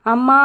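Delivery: a short loud cry as the bruise is touched; cry of pain. Acted. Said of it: "అని అరుస్తుంది".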